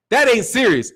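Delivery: ordinary talk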